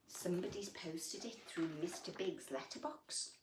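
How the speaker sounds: storybook voice for little ones; gentle picture-book reading; reading to children. A narrator's reading. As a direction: read it like bedtime story time. Reads picture book aloud.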